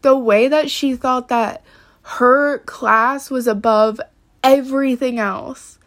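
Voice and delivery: usual speaking voice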